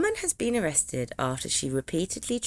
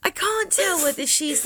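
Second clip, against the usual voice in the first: ordinary delivery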